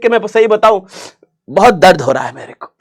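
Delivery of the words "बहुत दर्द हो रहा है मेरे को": mock grief. Mock pain about Pakistan.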